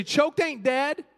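slow, drawn-out repeat for the church; slow call-and-response. Shown as a demonstration. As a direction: hand the line to the congregation slowly. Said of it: "Turn to your neighbor and say".

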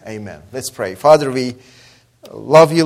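usual speaking voice

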